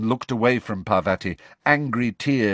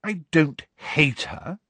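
slow, higher, superior Hermione; lofty; 6 semitones higher, slower than usual. A narrator's reading. Hermione answering Ron, lofty and higher. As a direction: above it all, a touch raised. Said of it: "said Hermione loftily".

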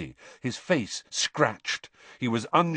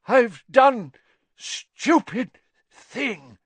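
high, slow, breathy confession; breathed; 12 semitones higher, 6 dB louder, much slower than usual. Acted. Crazed Crouch confesses to Harry in a breathy gasp. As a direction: breathe it out, broken. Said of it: "Mister Crouch breathed".

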